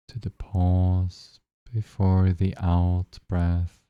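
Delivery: calm, even guiding voice with long pauses; unhurried phrases, spaced out with long silences; guided meditation. Acted. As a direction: lead listeners gently into noticing their breath.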